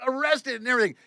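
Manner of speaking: high-pitched retold scolding; exasperated yelling. Driver hollering at tourists standing in the highway, high and exasperated. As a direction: holler it high, rushing at the end.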